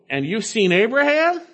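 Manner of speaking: incredulous challenge. Retold or imitated. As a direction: question it as if doubting the claim, voice climbing.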